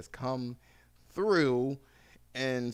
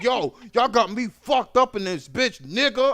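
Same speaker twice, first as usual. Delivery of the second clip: friend's outburst; 9 semitones higher, 9 dB louder than usual. Retold or imitated. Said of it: "He was like".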